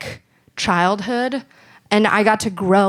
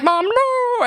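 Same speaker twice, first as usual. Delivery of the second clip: put-on panicked kid. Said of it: "And I'm like".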